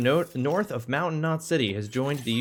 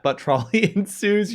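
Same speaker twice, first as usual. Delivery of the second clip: laughing; 7 semitones higher, 4 dB louder than usual. Real, not acted. Laughs rereading own misread.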